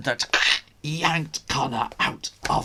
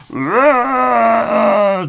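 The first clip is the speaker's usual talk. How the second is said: monster roar; 9 semitones higher, 10 dB louder than usual. Acted. Ogre roars.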